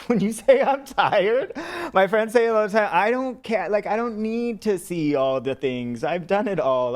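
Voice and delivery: lightly put-on old man's complaint; grumpy old man. Self-styled old man complains to friends, in mock grumpy protest.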